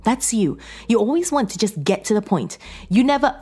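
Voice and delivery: high, loud nagging reproach; wife's heated fault-finding, cut off; scolding complaint. Acted. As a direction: chide the husband, pitched well up. Wife scolding husband in acted quarrel.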